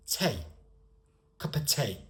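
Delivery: tea said as "tay" in a regional accent; dialect-accent tea; regional British accent. Shown as a demonstration. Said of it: "That's accent".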